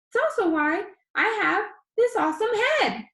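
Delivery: high, loud, chatty vlogger; high squeaky boy. Acted. Danny tells his viewers about his disease in a high squeaky voice. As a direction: rattle it off like an upbeat kid vlogger. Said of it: "His voice was high and squeaky".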